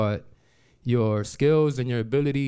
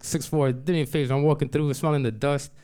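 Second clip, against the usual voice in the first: ordinary talk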